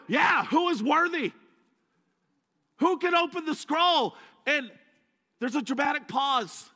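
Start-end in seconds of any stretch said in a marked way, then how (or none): 0.1-4.1 high, loud eager echo; John echoing. Retold or imitated. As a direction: join the cry as the crowd.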